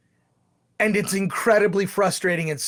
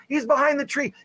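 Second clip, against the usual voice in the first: mock yell; 7 semitones higher than usual. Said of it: "They yell out".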